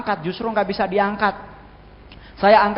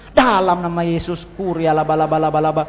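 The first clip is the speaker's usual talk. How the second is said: mock Pentecostal prayer. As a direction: imitate charismatic tongues, droning out the syllables. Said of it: "Pantekosta karismatik lebih dulu histeris".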